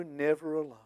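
usual speaking voice

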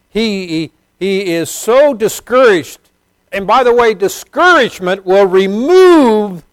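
high, loud pulpit cry, slowed on key words; fervent preacher lifting his voice high and loud; full-force preaching. Acted. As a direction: preach it out to the back row.

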